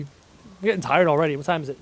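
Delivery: ordinary delivery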